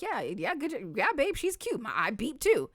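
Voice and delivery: high-pitched, easygoing mock agreement; breezy agreement. Wife agreeing with spouse that she's cute, breezy agreement. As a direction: play it totally unbothered, voice lifting. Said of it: "I'd be like".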